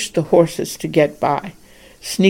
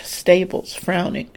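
ordinary delivery, nothing marked

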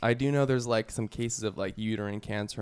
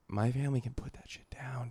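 whispering; 8 dB quieter than usual. Real, not acted. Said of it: "unvoiced aside".

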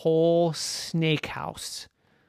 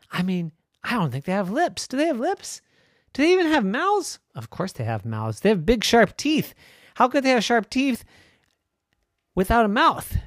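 put-on high, louder, wondering girl voice; excited little sister; 11 semitones higher, 6 dB louder than usual. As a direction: puzzle it out in a squeaky excited voice. Mary rambling about snake lips.

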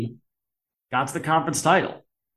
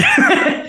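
sudden loud, high real laugh; squealing laugh; high burst of laughter; 5 dB louder than usual. Real, not acted. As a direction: break into giggles. Laughing at the joke.

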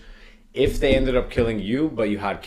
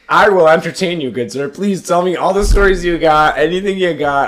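mock grand welcome; 7 semitones higher, 6 dB louder than usual. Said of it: "I don't get to see this kind of shit".

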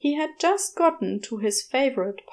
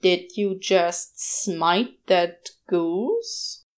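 very slow, drawn-out question; slow, disbelieving question to the angel; said slowly; 3 semitones lower, much slower than usual. Acted. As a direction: stretch every word of the question out. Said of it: "Crowley said slowly".

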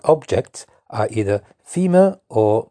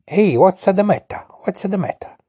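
mock Italian concern; 9 semitones higher, 4 dB louder than usual. Shown as a demonstration. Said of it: "you would say".

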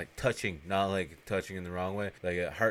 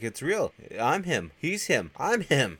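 raised, louder, short exclamations; imitated amazed realisation; 7 semitones higher, 5 dB louder, slower than usual. Joe spotting the sleigh in the sky, excited realisation. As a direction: act out sudden astonished excitement.

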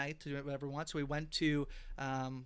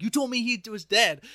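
higher and louder, mock outrage at being lied to; retold angry outburst; 7 semitones higher, 10 dB louder than usual. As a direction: replay the friend's furious accusation, hammed up. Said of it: "she freaks out on Angie".

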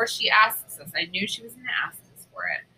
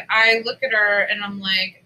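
ordinary delivery

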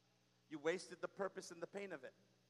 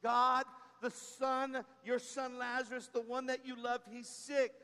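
urgent plea; 5 semitones higher, 7 dB louder than usual. Pretend. Sister begging God to come for Lazarus, urgent. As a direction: beg him to come now. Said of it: "remove God".

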